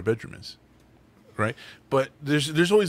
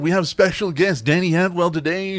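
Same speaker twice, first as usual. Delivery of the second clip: high, bright announcer welcome; show host intro; 7 semitones higher, 3 dB louder than usual. Acted. Host welcoming listeners to the episode, announcer voice. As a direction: sell the show opening like a host.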